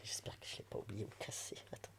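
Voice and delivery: unvoiced whisper while searching; whispering to herself. Real, not acted. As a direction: breathe the words to yourself as you rummage. Searching for the object, muttering to self.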